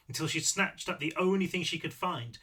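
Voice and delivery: ordinary delivery, nothing marked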